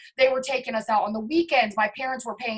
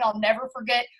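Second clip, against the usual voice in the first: usual speaking voice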